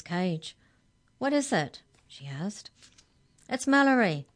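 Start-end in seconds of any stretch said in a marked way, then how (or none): 1.2-1.8 high, sharp questioning; impatient question. A narrator's reading. Hex checker answering Arthur's cry, sharp question. 3.5-4.3 high, loud, urgent plea; crying out. A narrator's reading. Arthur pleading for caged Mallory, crying out. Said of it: "cried Arthur".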